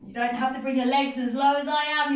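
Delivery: usual speaking voice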